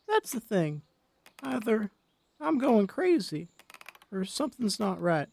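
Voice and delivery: put-on high, soft old woman voice; a soft, hesitant old lady. A narrator's reading.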